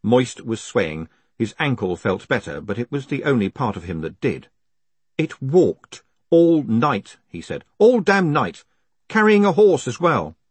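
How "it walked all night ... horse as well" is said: high, loud, aggrieved protest; indignant complaint. A narrator's reading. Captive complaining of being carried all night, indignant. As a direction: stretch and punch each grievance.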